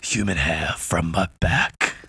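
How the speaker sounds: low, very slow botched movie pirate; Johnny Depp. Acted. Podcast host trading pirate lines, badly missed actor impression. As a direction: drawl it low, a failed star impression. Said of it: "I was trying to go for Johnny Depp".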